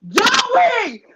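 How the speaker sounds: high loud call across the club. Retold or imitated. Bouncer calling for the manager over the club noise, loud. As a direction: yell the name to summon him.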